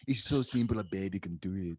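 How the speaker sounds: playful mock German accent. Shown as a demonstration. Co-host teasing that a baby could do it, in a German accent. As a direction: reassure in a put-on German accent. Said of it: "can't even get a German accent".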